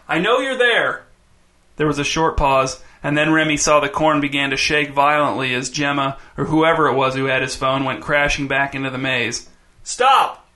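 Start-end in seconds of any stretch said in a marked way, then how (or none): 0.1-1.0 high-pitched calling shout; raised voice, pitched well up. A narrator's reading. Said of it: "shouted Remy". 9.8-10.5 high, urgent shouted command; sharp high-pitched call. A narrator's reading. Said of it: "shouted Remy".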